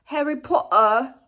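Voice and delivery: put-on failed British accent, slightly high; mock British Harry Potter line; bad British accent. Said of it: "I can't do a British accent".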